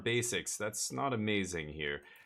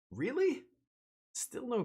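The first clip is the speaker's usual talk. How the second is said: incredulous; slower than usual. Real, not acted. Frustrated at missing cavern.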